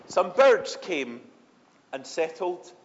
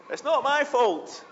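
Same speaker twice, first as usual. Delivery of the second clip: high, slow it's-not-my-fault whine; a hint of a panicked Moses; defensive protest; 7 semitones higher, 3 dB louder than usual. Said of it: "Moses might have been tempted to run away".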